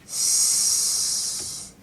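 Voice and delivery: S said as a long hissing sss, no voice; letter sound demo. Shown as a demonstration. Teacher sounds out S for children as a long hiss. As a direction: hiss out S like a snake. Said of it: "This one is".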